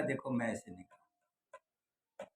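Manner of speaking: low, hushed, slow retold reply; meek mock refusal. Storyteller as the simpleton refusing Hanuman, meekly acted. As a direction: shrink back and refuse softly.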